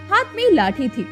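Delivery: plain reading voice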